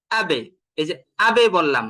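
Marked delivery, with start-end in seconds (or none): 0.1-0.4 আবে with lips sealed on b, then bounced; lips-closed qalqalah. Shown as a demonstration. Teacher shows lips fully joined, b of আবে popped with qalqalah. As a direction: seal the lips for the b in আবে and release an echo. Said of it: "একদম ঠোঁটটাকে মিলিয়ে ফেললাম".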